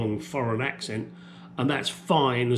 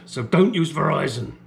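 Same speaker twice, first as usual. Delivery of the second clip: normal talking voice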